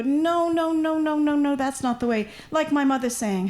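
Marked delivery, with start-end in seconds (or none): 0.0-2.3 high, insistent no no no; lightly voiced know-it-all; mock objecting local. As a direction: play the fussy native speaker correcting you. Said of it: "somebody who's gonna go".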